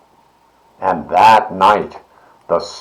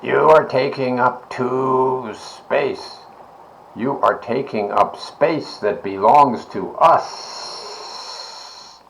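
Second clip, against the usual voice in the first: slow, put-on snake voice; 4 semitones higher, 4 dB quieter, slower than usual. A narrator's reading. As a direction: stretch it out like a sly snake. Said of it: "says a big snake".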